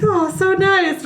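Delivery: high, drawn-out swoon; cooing admiration. Retold or imitated. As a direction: coo slowly, far up in pitch. Voices girl admiring the curtains.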